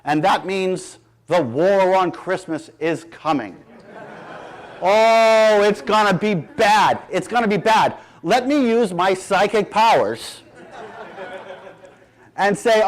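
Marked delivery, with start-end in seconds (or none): 4.8-7.9 hammed-up ominous warning, louder; mock dread. Speaker teasing the crowd about the war on Christmas, mock dread. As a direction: ham up the doom, tongue in cheek.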